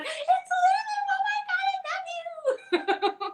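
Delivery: very high, screaming delight; fan squeal. Retold or imitated. Security guard fan recognizing her, squealing with joy. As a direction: shriek like a fan meeting her idol. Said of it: "She's like".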